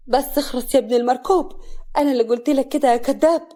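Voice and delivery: loud angry snap at the liar. A narrator's reading. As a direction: tell him to shut up in fury. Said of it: "سعاد بغضب".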